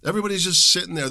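usual speaking voice

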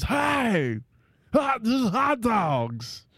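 high, drawn-out cartoon sportscaster; Will Ferrell as Harry Carey. Mocking the impression's legacy, exaggerated Harry Carey caricature. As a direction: stretch it into a hammed-up announcer caricature. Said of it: "Will Ferrell's just doing, like, a caricature".

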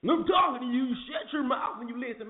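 high mock shouting of a cop's order; imitating a barking cop. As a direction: ham up the cop yelling at a driver. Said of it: "That's how they talk to you".

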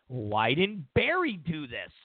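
mock a puzzled voter; baffled voter. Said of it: "the American people are gonna go".

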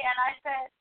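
ordinary talk

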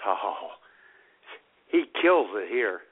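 ordinary talk

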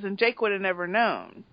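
normal talking voice

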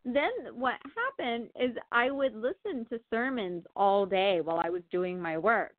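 ordinary delivery

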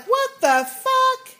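retold high, drawn-out outburst; angry disbelief. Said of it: "I was angry at Rick".

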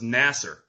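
Nasser said with a final r sound, like nass-er; mock r-ending pronunciation. Ribbing the host's accent, Nasser with a tacked-on r. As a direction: tease it as Nasser with an added r. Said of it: "there's no R in nasa".

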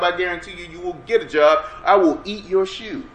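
ordinary talk

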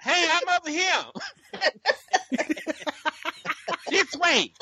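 high, giggling shout to the group; laughing call-out. Retold or imitated. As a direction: call out while cracking up. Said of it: "I'm like".

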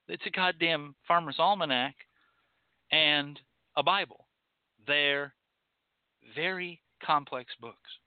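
ordinary talk, nothing marked

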